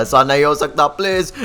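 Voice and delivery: ordinary talk, nothing marked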